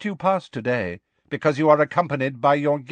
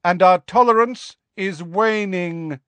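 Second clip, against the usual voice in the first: calling after; 10 semitones higher, 4 dB louder than usual. A narrator's reading. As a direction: project it after someone leaving. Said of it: "Megorian called after them".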